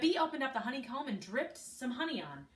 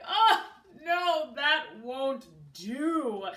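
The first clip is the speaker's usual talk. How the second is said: louder, drawn-out dismayed queen ant; 3 semitones higher, 8 dB louder, much slower than usual. A narrator's reading. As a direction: moan that peanut butter won't do. Queen refuses the sandwich.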